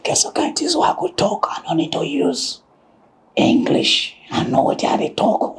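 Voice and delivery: hushed, breathy secret-telling; whisper. Real, not acted. Whispering a secret to the viewers.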